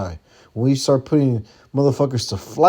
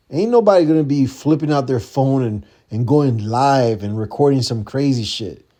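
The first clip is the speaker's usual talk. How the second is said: ordinary talk, nothing marked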